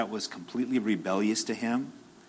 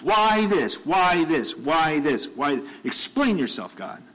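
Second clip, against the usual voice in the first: demanding God explain; 4 semitones higher, slower than usual. Retold or imitated. Job demanding God explain himself, indignant. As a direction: demand answers, insistent. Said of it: "questions he wanted to ask God".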